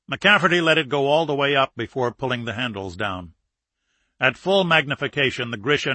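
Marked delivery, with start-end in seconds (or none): none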